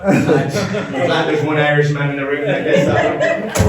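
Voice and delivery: chuckling through the words; laughing. Real, not acted. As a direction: laugh as you say it. Joke lands with one listener.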